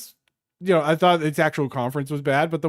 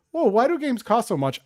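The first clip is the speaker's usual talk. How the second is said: higher-pitched pretend disbelief; mock bafflement; 8 semitones higher than usual. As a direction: ask it like a clueless complainer. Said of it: "and it's like".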